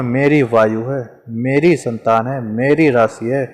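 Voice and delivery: ordinary delivery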